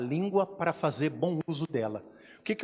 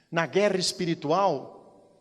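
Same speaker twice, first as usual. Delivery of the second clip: ordinary talk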